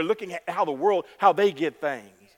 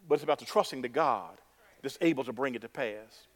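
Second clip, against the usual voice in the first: ordinary talk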